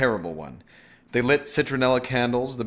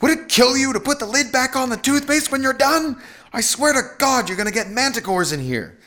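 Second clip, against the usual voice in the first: high, loud angry scolding; raised-voice nagging, high pitch; fuming; 5 dB louder than usual. A narrator's reading. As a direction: scold furiously as a fed-up wife. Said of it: "his wife came fuming".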